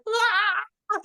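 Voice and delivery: a sudden high, loud "Ah!" of exasperation; frustrated cry. Retold or imitated. Retelling how she felt as a child, mock frustrated cry. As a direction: yelp of helpless frustration. Child reacting to guilt trip.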